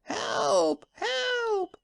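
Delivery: very high frantic cries for help; shouting wildly. Acted. Husband runs out of the house shouting wildly for help. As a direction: yell for help at the top of the voice. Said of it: "shouting wildly".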